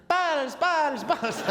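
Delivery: high mock Netherlands accent; squeaky, hammed-up Netherlands-accent echo. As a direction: imitate giggly girls with a Netherlands accent. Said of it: "That's like my Netherlands accent".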